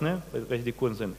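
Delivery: ordinary talk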